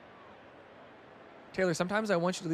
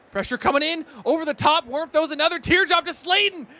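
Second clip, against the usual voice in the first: higher and louder hyped commentary; excited call; 8 semitones higher, 8 dB louder than usual. Real, not acted. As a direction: call the throw with rising excitement.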